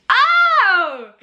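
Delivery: sudden high loud oh; startled. Real, not acted. Reading a cheeky question, a startled high yelp. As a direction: caught off guard, yelps.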